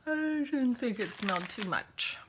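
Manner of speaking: talking through a yawn. Real, not acted. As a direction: say it mid-yawn. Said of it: "Sorry for the yawning".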